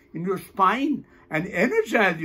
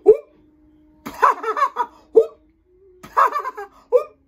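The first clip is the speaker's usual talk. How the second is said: short whoop, then very high staccato laugh; whoop-and-laugh exercise. Shown as a demonstration. Teacher demonstrates an oxygen exercise, whoops and high laughter. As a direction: suck in a whoop and burst out laughing. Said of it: "So do like this".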